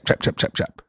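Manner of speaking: very high, whispered "chop, chop"; chopping noises. Retold or imitated. Describing a stylist chopping shrimp, whispered mock chops. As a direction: mimic a knife chopping softly.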